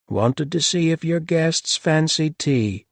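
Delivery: flat monotone. A narrator's reading. The housekeeper offering tea, slow flat monotone. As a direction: dull and expressionless. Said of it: "in a flat, uninflected monotone".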